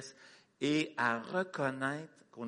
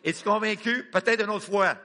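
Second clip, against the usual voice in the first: higher and louder, pressing; rousing challenge; 7 semitones higher, 8 dB louder than usual. Real, not acted. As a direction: challenge the crowd to shout louder. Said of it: "je veux entendre la conviction".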